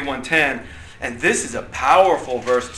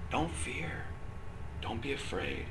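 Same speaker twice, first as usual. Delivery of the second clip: much softer, close and gentle; God speaking softly; 18 dB quieter than usual. Retold or imitated. Teacher voicing God to an anxious heart, softly. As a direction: hush it like God comforting a tight heart. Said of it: "and you hear God saying".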